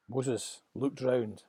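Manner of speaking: ordinary delivery, nothing marked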